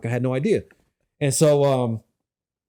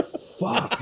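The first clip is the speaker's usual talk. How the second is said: breathless curse; 6 semitones higher, 8 dB quieter than usual. Retold or imitated. Exhausted, clinging to pool wall.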